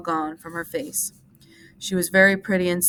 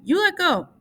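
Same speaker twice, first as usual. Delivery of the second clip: frightened cry; 12 semitones higher, 6 dB louder than usual. A narrator's reading. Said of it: "she cried".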